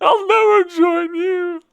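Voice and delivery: high, slow whining defiance; whiny Luke voice. Retold or imitated. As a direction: whine it high and drawn out. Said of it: "the whiny voice from Luke".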